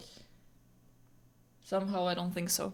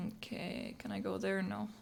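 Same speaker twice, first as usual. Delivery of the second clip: whispering; 8 dB quieter than usual. Real, not acted. Whispered aside.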